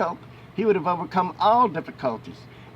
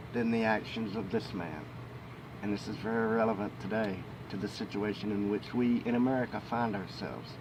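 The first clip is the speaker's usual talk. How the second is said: quiet aside; 6 semitones lower, 10 dB quieter than usual. Real, not acted. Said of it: "So, there we go".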